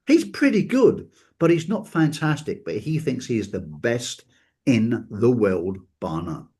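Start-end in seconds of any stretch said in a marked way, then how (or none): none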